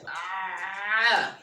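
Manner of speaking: Ah pressed from the middle of the throat, strained; squeezed throaty Ah; throat ain. Shown as a demonstration. Teacher demonstrates ain from the mid throat. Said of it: "you feel like something stuck there".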